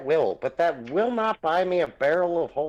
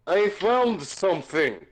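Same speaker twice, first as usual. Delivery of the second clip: half-hearted yell up from a hole; slow, raised, drawn-out call; 5 semitones higher, slower than usual. Acted. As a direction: call up to friends far above, mildly hammed-up. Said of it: "looks up at the top of the hole and yells out".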